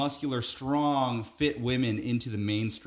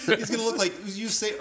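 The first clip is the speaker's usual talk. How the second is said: usual speaking voice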